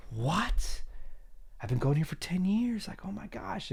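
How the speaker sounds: high-pitched, crushed disbelief; hammed-up devastation in a high voice; mock devastated. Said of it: "And you're devastated".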